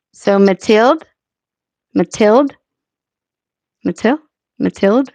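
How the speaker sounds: "Matilde" ending on the d, rising questioningly; silent E. Shown as a demonstration. Streamer testing a name with silent E, questioning. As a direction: say "Matilde" with no vowel after the d. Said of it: "with the silent E?".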